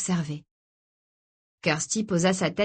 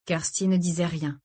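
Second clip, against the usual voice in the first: usual speaking voice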